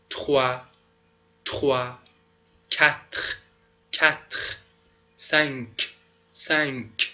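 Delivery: each French number said twice, clearly; French numbers read twice. Shown as a demonstration. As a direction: count aloud in French for the class. (شمردن اعداد فرانسوی با تلفظ شمرده)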